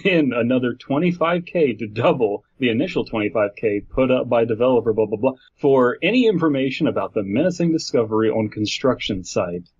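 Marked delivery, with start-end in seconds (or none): none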